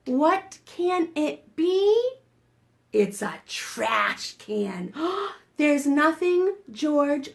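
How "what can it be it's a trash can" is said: ordinary delivery, nothing marked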